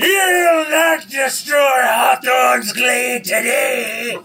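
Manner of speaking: high, slow, drawn-out war cry; defiant little creature. Acted. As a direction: bellow defiance as a tiny monster. Attacking creature shouts defiance.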